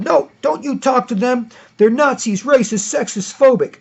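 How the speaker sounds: hammed-up outraged activist; mock mob zealot. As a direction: sneer like a frantic mob member. Acted mob reply.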